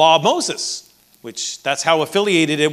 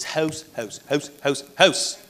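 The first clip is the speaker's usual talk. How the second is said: put-on house said as hoose in a light Canadian accent, softer; 2 semitones lower, 6 dB quieter than usual. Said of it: "biffing that Canadian accent".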